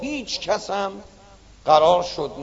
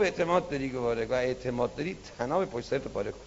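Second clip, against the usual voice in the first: quiet command; 8 semitones lower, 9 dB quieter, much faster than usual. Acted. God softly commands.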